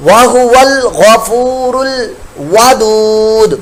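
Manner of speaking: slow melodic tajweed recitation; slow measured Quran chant. Shown as a demonstration. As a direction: chant the verse slowly and evenly. Teacher recites the verse.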